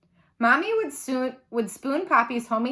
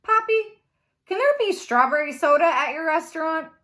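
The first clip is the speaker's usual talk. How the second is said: put-on eager child's question; 6 semitones higher, 4 dB louder than usual. A narrator's reading. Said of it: "I asked".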